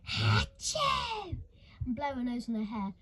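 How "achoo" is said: big high wet sneeze; loud sneeze. Acted. Missus Curdle sneezing on orphans, loud hammed-up sneeze. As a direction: blast out an exaggerated sneeze. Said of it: "would sneeze all over".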